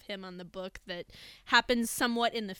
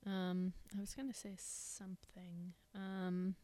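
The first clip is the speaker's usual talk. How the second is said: whispering; 14 dB quieter, slower than usual. Real, not acted. Whispers mid-conversation.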